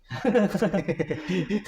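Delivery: amused, high chuckling laugh. Real, not acted. Guest amused by the host's ronda joke, laughing. Joke about the night watch.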